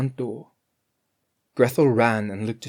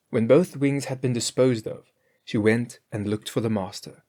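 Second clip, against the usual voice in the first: ordinary delivery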